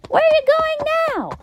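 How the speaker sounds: high, fast exasperated holler. Acted. Narrator hollering after the runaway unicorn, high and fast. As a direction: holler after her as she runs off. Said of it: "I hollered".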